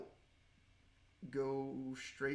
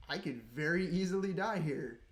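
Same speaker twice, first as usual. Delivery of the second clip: nervous excitement; 3 semitones higher, 7 dB louder than usual. Real, not acted. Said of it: "hella nervous".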